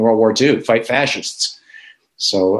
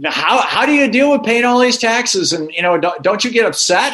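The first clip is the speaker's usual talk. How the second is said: much higher, imitating a baffled young reporter; incredulous reporter; 13 semitones higher than usual. Reporter pushing the businessman again, imitated high incredulity. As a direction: press the question like it can't be true. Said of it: "this reporter is like".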